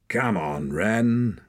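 understated impatient snarl. A narrator's reading. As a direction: snarl lightly to hurry her. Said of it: "snarled Hord".

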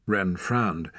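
ordinary delivery, nothing marked

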